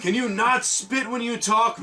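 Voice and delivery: high, slow mock yell; mock shout. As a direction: yell it in a hammy way. Mocking the orc speech.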